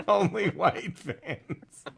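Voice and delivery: slow words through laughter; laughing. Real, not acted. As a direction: savor the joke while laughing. Laughing slow repeat of joke.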